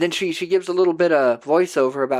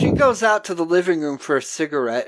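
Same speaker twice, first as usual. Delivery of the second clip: ordinary talk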